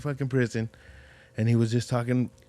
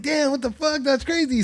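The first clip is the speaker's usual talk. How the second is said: high, stunned exclamation; amazed; 12 semitones higher than usual. Retold or imitated. Telling his friend, shocked disbelief. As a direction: sound floored by the news. Reacting to jail story, amazed.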